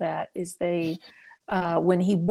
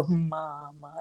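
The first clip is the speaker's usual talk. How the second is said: soft, low, effortful "Mama"; child learning to speak; 4 semitones lower, 8 dB quieter than usual. Retold or imitated. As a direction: imitate a child's hummed first "Mama". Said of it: "so then he'd go".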